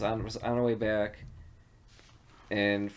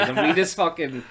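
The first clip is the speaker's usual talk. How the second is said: ordinary talk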